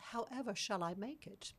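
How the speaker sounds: put-on British accent, softly spoken; British stewardess. British stewardess answering a request for iced tea, mock British accent. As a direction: imitate a puzzled British stewardess. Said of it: "asked the stewardess".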